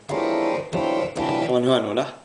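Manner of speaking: usual speaking voice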